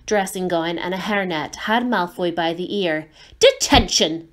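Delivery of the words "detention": loud stern shout. Acted. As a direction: bark it like a strict teacher. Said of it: "she shouted".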